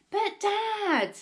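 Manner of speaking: very high, loud interruption; exasperated protest. A narrator's reading. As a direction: break in desperately to be heard. Boy interrupts his excited father.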